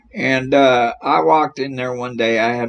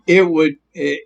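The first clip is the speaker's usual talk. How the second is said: usual speaking voice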